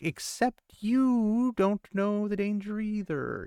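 high, drawn-out pointed comeback; mock retort. Reader talking back to the character who scolds, mock-indignant.

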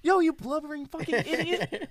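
very high, loud comic scolding; mock outrage. Berating his friend over the TV story, mock outrage. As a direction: squawk the insult in exaggerated exasperation. Acted angry phone call to friend.

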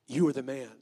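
low, loud pronouncement; grave accusation. Acted. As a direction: deliver the verdict deep and forceful. Nathan's accusation of David, acted.

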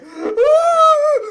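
very high, loud scream; full-force shriek of spider panic; reenacted scream. Storyteller reliving spider on arm, reenacted scream.

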